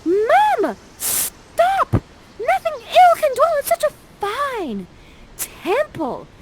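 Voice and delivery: put-on squealing teen begging her mother; pleading daughter. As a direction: shriek at mom to stop the fight. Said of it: "she's like this".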